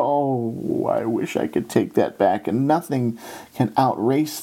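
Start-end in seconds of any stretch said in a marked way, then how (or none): 0.0-2.5 regretful sigh. Retold or imitated. Preacher regretting words said in anger, rueful murmur. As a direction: wince as the words slip out. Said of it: "I go".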